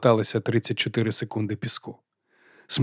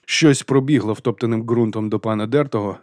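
ordinary delivery, nothing marked

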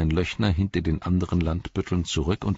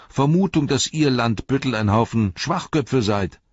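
higher and louder, mocking list of charges; cheeky Sam; 9 semitones higher, 6 dB louder than usual. Sam mocking the shirriffs, cheeky and higher. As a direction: rattle off insults with relish.

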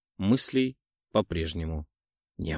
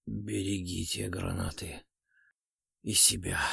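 faint, fading voice; weak; 3 semitones lower, 8 dB quieter than usual. A narrator's reading. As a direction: fade out on the words. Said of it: "вяло протянул азиат".

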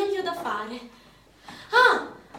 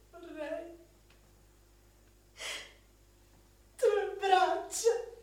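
slow, tearful plea; slowed, weeping longing; crying; much slower than usual. Acted. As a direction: let the voice break into a sob. Repeated wish breaks into crying.

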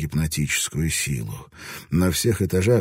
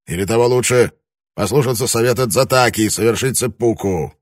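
rising irritation; 5 semitones higher, 6 dB louder than usual. A narrator's reading. Irritated lord pressing his wife.

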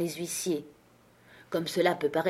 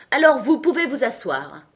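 higher, louder royal order; imperious retort; 5 semitones higher, 5 dB louder than usual. A narrator's reading. King retorting to the Hatter, imperious order.

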